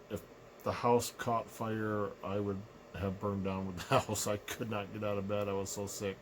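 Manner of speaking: ordinary delivery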